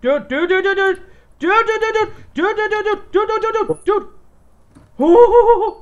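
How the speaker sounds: rising frantic shouts of dude; panicked yelling. Real, not acted. Ambushed, yelling dude repeatedly.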